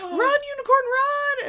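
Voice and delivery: high, slow, crying yell; urgent shout. Retold or imitated. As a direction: yell at the unicorn to flee. Urging unicorn to flee Red Bull.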